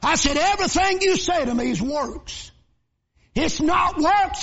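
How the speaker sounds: shouted, high-pitched preaching climax; full-force preaching. Acted. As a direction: preach it loud and high over the music.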